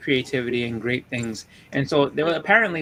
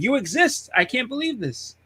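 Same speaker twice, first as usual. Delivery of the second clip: amazed; 8 semitones higher, slower than usual. Retold or imitated. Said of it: "I was like".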